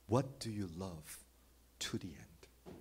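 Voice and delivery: whispered question; hushed, searching whisper. Real, not acted. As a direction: drop to a whisper to let it sink in. Sermon, rhetorical question hushed.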